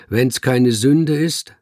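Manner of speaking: slow, loud, deliberate statement; clear, loud voice. A narrator's reading. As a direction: speak up clearly before the room. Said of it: "sagte er mit klarer, lauter Stimme".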